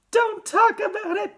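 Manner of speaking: very high, loud wail; anguished high-pitched plea. A narrator's reading. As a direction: wail it like a distraught mother. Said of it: "wailed Missus T V".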